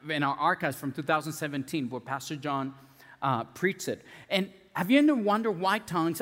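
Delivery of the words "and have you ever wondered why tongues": higher, louder preacher's question; preaching shout. Real, not acted. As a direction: call it out to the church. Preacher hits the point loudly.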